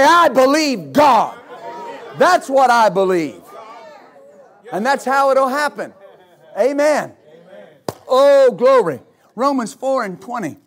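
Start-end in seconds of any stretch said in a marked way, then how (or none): 0.0-1.4 slow, loud, every word punched; declaring belief. Acted. Pastor models the believer's answer to problems, slow loud declaration. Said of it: "you say". 2.2-9.0 loud, high, fervent preaching with slow punched phrases; preaching at full force. Acted. Pastor rallies the congregation against giving up, preaching at full force.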